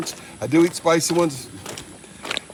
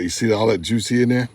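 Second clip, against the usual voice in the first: normal talking voice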